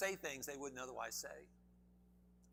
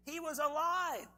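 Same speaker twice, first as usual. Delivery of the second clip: higher, louder, slow, ringing out; exultant proclamation; 10 semitones higher, 5 dB louder than usual. Acted. Preacher declaring to the congregation, exultant and slow. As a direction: proclaim it like good news.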